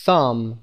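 "thumb" with th, tongue tip between the teeth; th sound in thumb. Shown as a demonstration. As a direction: model "thumb" with a clear th.

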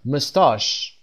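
مستاش with weak first vowel, long "ah" in second syllable; British pronunciation. Shown as a demonstration. Teacher showing British English to Persian learners, مستاش as "muh-STAHSH".

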